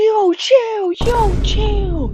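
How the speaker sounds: high, loud hyped yell; excited shout. Real, not acted. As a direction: shout it at the screen, amped up.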